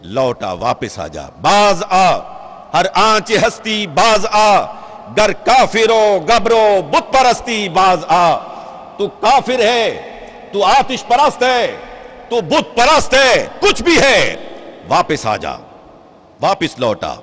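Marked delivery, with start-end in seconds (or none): 1.4-8.4 forceful Persian verse; full-voiced poetic appeal; ringing recitation. 9.0-14.3 high, emphatic listing; raised, insistent preacher's appeal; impassioned summons. Acted. Preacher voicing God's summons to sinners. 14.9-17.1 low, softened appeal; tender, lowered call to return; gentle summons. Acted. Gentle call back after the list.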